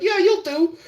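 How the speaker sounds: high mimicking voice; woman sizing him up. Imitating a woman eyeing him, high approving voice. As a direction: pitch it up, imitating her approval.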